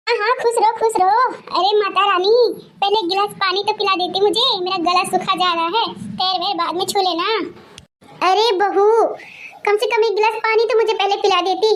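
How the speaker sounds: cranky grandma demanding water first; grumbling grandmother. Acted. Grandmother scolds daughter-in-law.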